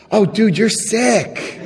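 high, slow, laughing mock disgust; teasing disgust. Teasing his sponsor about his secret, slow laughing mock disgust. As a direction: drag it out, teasing and amused. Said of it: "And I was like".

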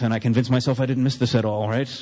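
usual speaking voice

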